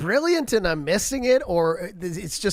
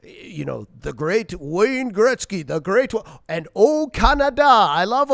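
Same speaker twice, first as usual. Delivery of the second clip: mock gushing Trump, building louder toward the end; host mimics Trump's gushing praise, getting louder; Trump impression; 2 semitones higher, 5 dB louder than usual. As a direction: ham up a boastful president fawning over Canada. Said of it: "And then he'll say".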